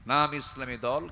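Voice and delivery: usual speaking voice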